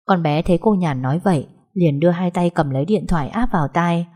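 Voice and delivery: plain reading voice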